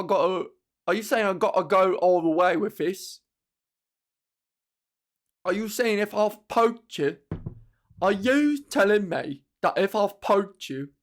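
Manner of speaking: loud mock cockney outrage; cockney Jack the Ripper. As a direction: Jack the Ripper taking offence, building up. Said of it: "Jack the Ripper was like".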